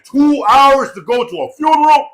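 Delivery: loud, high comic complaint; mock outraged yell. Retelling family's gripe about the funeral drive, mock outraged yell. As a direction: exclaim it in exaggerated disbelief.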